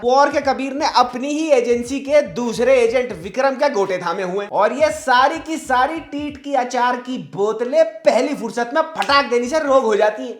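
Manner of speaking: ordinary delivery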